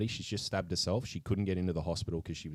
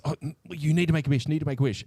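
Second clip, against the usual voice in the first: higher, quicker pushy urging; eager urging; 8 semitones higher, 5 dB louder, much faster than usual. Retold or imitated. Bear pressing his best friend to wish, quick eager voice. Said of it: "and says".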